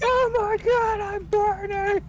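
high, loud, slow burning shriek; panicked scream. Retold or imitated. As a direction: shriek in mock agony. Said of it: "I still love that".

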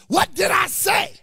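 loud, high exasperated parent yell; parent's yell. Retold or imitated. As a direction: blow up at the kid who won't clean. Parent finally yells at kid.

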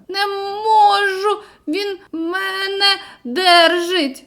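high, very slow strained cry, near tears; gasping desperate cry. Acted. Drowning boy answers friend, gasping and desperate. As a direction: barely get the words out before sinking. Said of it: "ледве встигає вигукнути".

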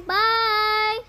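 slow, loud drawn-out bye; cheerful goodbye. Real, not acted. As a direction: wave off the viewers. Signing off the video.

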